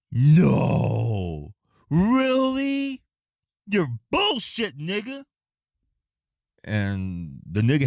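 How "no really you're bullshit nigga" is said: much higher, hammed-up fake shock; mock disbelief. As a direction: play dumb, squeal it in mock surprise. Mock-shocked reply to the lady.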